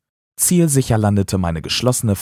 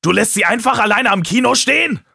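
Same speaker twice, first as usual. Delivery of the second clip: raised, reproachful confrontation; angry accusation; 8 semitones higher than usual. Acted. Taylor confronts his best friend over his sister, angrily. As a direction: accuse him of betraying the friendship.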